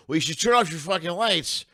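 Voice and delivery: half-hammed drunk yell at the closed taco stand; retold yell. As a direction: yell it back, indignant. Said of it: "And I yell".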